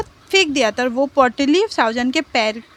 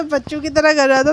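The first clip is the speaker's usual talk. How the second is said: ordinary delivery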